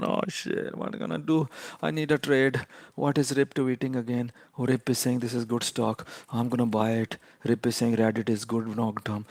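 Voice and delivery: whiny losing trader. Retold or imitated. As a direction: lightly imitate a trader fretting over his losses. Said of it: "I'm not gonna cry about it all day".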